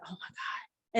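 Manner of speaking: hushed, overwhelmed whisper; awed whisper. Retold or imitated. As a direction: whisper it as if stunned by the moment. Retells awed reaction in cinema.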